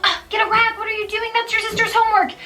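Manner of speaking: high, sharp frustrated snap; snapping at child. Pretend. Mom snapping at her four-year-old over spilled juice, high and frustrated. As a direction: snip at the kid in a rush. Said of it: "you snip at the four-year-old".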